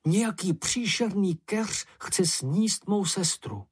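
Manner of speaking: understated horrified cry, no real shout; terrified crying out. A narrator's reading. Ferka reports the bush eating his sister, frightened cry. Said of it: "byl modrý hrůzou a křičel".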